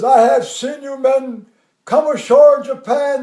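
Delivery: loud oratory to assembled troops; colonel's speech. Retold or imitated. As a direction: imitate a commander addressing his army. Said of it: "the colonel's there".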